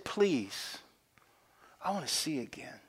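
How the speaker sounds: soft, near-whispered begging; hushed plea. Acted. Blind man asking Jesus for sight, hushed. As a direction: plead under the breath.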